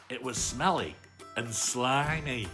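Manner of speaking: plain reading voice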